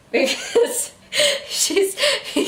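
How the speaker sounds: words squeezed out through laughter; laughing. Real, not acted. Host cracking up explaining the glowing tattoos, laughing. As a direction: laugh while trying to explain.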